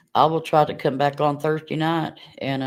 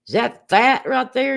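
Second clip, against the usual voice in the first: imitating her little boy; 9 semitones higher, 5 dB louder than usual. Son's innocent question retold.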